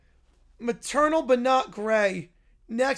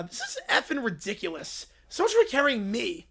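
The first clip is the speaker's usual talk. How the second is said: normal talking voice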